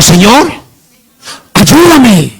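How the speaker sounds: loud, high call to repeat; rallying cry. Real, not acted. Preacher leading congregation in asking for help, rallying cry. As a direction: lead the church in a forceful plea.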